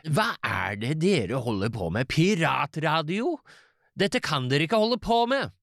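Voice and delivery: put-on old-film national theatre østlandsk; Nasjonalteater østlandsk. Shown as a demonstration. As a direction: declaim it in stagy østlandsk. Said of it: "Nasjonalteater, eh, østlandsk".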